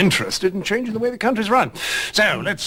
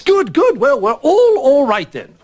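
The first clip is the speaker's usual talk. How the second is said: flustered cheerfulness; 8 semitones higher, 7 dB louder than usual. Acted. Flustered overbright reassurance.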